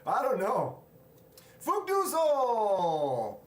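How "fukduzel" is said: loud, high holler stretched long; drawn-out greeting call. Real, not acted. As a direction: call out the name, dragging the last syllable. Calls out a viewer's name in greeting.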